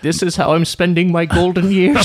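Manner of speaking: put-on high, hacky old lady voice; granny voice. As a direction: play a tired old lady. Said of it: "It was super hacky".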